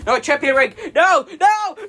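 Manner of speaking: high panicked no; terrified shriek. Acted. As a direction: scream in terror as it takes over. Announcer shrieks as villain arrives.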